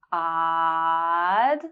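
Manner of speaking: long drawn-out ah in "Odd"; held ah. Shown as a demonstration. Teacher drawing out the ah in "Odd" for learners. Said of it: "has the ah sound right at the beginning".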